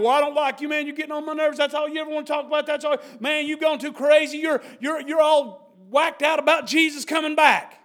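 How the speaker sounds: loud, high, scornful jeer at the preacher; mocking scoffer. Scoffer jeering at a friend who talks about Jesus, loud and scornful. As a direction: sneer it like a fed-up friend.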